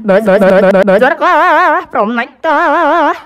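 drawn out. Acted. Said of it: "drawn-out questions".

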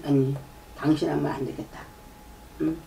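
usual speaking voice

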